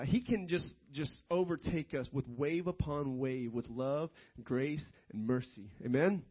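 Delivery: ordinary talk, nothing marked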